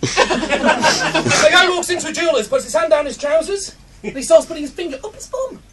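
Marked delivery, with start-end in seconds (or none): none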